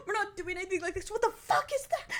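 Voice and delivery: lightly voiced meme quote, rising high and fast at the end; a quick mock replay of a famous movie meme; quoting the meme. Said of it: "Had some good memes too".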